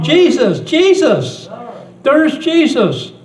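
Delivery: put-on high, loud shout of a thrilled little girl; excited child screaming. Granddaughter spotting Jesus at the pageant, high excited scream. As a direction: cry out his name like an ecstatic child.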